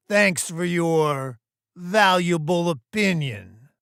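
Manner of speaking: loud, high, drawn-out mock gratitude; sarcastic thanks. Boss thanking Theodore for his opinion, sarcastic. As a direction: stretch the thanks out to belittle him.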